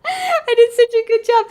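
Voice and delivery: high-pitched proud gushing; delighted squeal. Real, not acted. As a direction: gush in a high, giddy voice. Repeats delighted self-praise after laughing.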